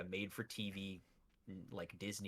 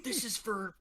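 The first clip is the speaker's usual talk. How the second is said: mock revenge cry; 10 semitones higher, 4 dB louder than usual. Acting time traveler's revenge line.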